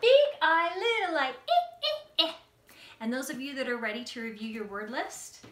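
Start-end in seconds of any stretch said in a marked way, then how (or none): 0.0-2.3 high-pitched insect voice. Shown as a demonstration. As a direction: squeak the letters like a tiny insect. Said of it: "Let's do with our insect voice".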